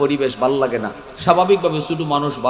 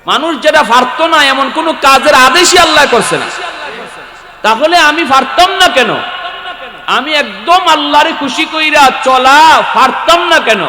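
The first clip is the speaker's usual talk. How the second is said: high, loud rhetorical challenge; raised, ringing sermon delivery; full-force preaching; 13 semitones higher, 9 dB louder than usual. Real, not acted. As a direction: preach it out loudly to the crowd.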